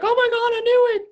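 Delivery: a buyer bursting with joy on the porch; excited squeal. Retold or imitated. Buyers thrilled as the door opens, squealing with joy. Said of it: "they'll get so excited and amped up".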